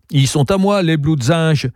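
put-on high, indignant little girl's voice; girl accusing. Girl warns her uncle the visitor is a cop. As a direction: tattle on him to your uncle.

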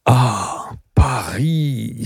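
long drawn-out, savoured exclamation; relishing enthusiasm. A narrator's reading. Gabriel admiring the view of Paris, gourmand enthusiasm. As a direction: sigh it out, savouring the name. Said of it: "s'écria Gabriel avec un enthousiasme gourmand".

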